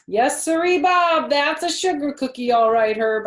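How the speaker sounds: put-on loud, high little girl voice; pushy, hinting kid voice; Junie B. voice.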